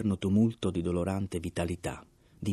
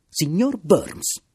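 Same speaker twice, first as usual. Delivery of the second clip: shouting; 11 semitones higher, 9 dB louder, much slower than usual. A narrator's reading. Narrator shouts at Mr Burns in disbelief, loud and high.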